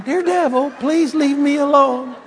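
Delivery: high-pitched imitation of begging; mock pleading. Preacher mocking Christians begging the devil, mock pleading. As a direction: plead helplessly, hammed-up.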